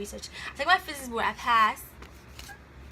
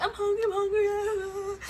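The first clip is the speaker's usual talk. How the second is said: put-on higher, slow, whining child voice; whiny kid; 9 semitones higher, slower than usual. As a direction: drag it out like a hungry little kid begging. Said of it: "with kids, they're like".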